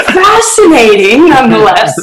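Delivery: high, drawn-out words through laughter; laughing emphasis. Real, not acted. Guest joking about serial killers, laughing. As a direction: stretch it out high while laughing.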